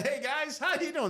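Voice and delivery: high, bright casual hello; breezy hello as if nothing happened; cheery greeting. Retold or imitated. Badly hurt patient breezily greeting the rushing medics, high and cheery. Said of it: "I'm laughing".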